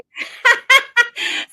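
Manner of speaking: sudden high giggle; high laugh. Real, not acted. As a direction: break into a high embarrassed laugh. Laughs mid-sentence about Roy.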